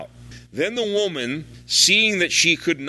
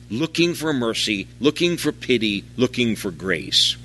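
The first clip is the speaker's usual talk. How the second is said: usual speaking voice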